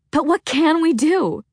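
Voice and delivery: desperate. A narrator's reading. Freya desperate.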